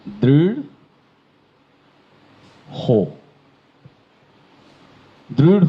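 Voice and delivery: দৃঢ় ending in a breathy rh; aspirated ঢ় demo. Shown as a demonstration. Teacher demonstrating দৃঢ় with added h. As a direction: add a puff of h after the flap in দৃঢ়. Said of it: "এটির উচ্চারণ হচ্ছে".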